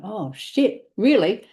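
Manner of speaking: stunned exclamation, rising loud at the end; shocked. Retold or imitated. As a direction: caught off guard by the reveal. Shocked reaction at the reading.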